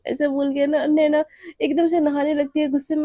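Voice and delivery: ordinary delivery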